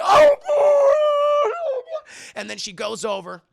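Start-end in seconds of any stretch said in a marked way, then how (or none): 0.0-2.1 very high, loud, drawn-out cry; mock horrified wail. Captive sees the melted body, high hammed-up wail. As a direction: wail in exaggerated horror.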